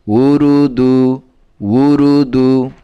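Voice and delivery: measured, chanting recital of spelled syllables; recitation drill. Shown as a demonstration. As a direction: read each syllable out slowly like a teacher drilling a class. (ধীরে ধীরে সুর করে বানান পড়া)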